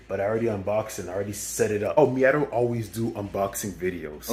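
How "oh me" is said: ordinary talk, nothing marked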